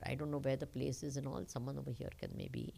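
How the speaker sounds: ordinary delivery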